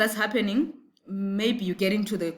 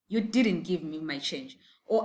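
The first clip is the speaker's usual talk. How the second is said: ordinary delivery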